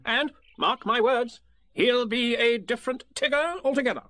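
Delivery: high, bossy little rabbit voice; fussy Rabbit. Acted. Rabbit pressing Piglet to agree, bossy and high. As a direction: lay out the plan like a busy organiser.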